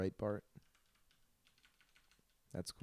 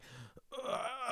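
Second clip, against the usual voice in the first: much higher squeal breaking into laughter; mock noise, cracking up; 5 dB quieter than usual. Planning to make that noise in bed, laughing squeal. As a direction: try the noise and lose it laughing. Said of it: "just going".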